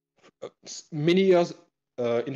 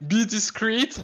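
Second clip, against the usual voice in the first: half-laughing, a bit higher; 10 semitones higher, slower than usual. Real, not acted. Embarrassed streamer laughing while begging viewers for discretion. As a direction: giggle through the plea. Embarrassed about old Flipnotes.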